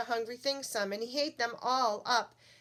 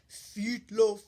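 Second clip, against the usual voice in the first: loud, booming creature demand; monster voice; 7 dB louder than usual. Acted. Hungry thing answers the townspeople in a loud monster voice.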